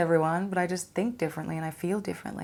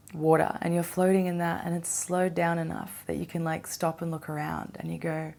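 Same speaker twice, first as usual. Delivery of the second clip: ordinary delivery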